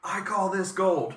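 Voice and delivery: slow, high grand pronouncement; pompous wine snob. Pretend. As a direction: declare it like a snooty sommelier. Echoes with a grander voice.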